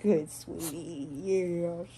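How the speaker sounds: slow, loud affectionate coo. Real, not acted. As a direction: dote on the pet. Baby talk to dog.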